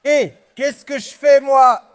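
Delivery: slow furious shout; raging gamer. Real, not acted. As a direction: yell it out in frustration. Friends shouting during wood hauling. (crier avec rage)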